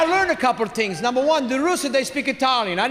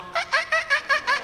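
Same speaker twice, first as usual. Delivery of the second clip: imitated squeak; 3 dB quieter, faster than usual. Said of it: "It's going".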